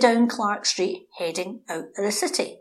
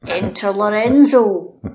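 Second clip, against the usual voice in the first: slow, louder stage-play announcement; dramatic entrance; 8 dB louder, slower than usual. Acted. Storyteller bringing the lion tamer back in, slow theatrical announcement. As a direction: announce him like a hero walking on stage.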